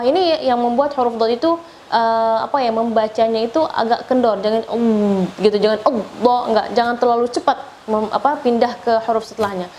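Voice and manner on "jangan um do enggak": ordinary talk, nothing marked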